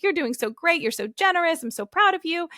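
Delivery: high-pitched gushing encouragement; enthusiastic praise. Retold or imitated. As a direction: praise a small child proudly. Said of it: "I was enthusiastic about it too".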